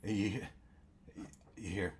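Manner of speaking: ordinary delivery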